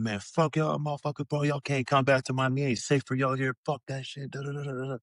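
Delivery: a hint of a high taunting fan; lightly voiced courtside trash talk, trailing off; mocking a heckler. Courtside heckler taunting the Pacers, lightly mocked high voice. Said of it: "little motherfucker talking wild shit".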